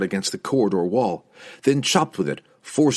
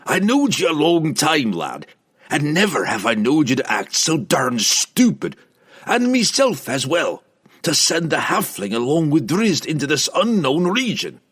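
higher, gruff rustic dwarf voice; exasperated dwarf's raised voice; chiding old dwarf; 6 semitones higher, 3 dB louder than usual. A narrator's reading. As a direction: chide the lad and yourself in dwarfish dialect. Dwarf chides barbarian and himself.

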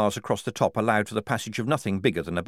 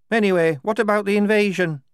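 put-on higher, louder young woman's voice; a recruit fretting about the invasion; worried recruit; 10 semitones higher, 6 dB louder than usual. Soldier raises objection.

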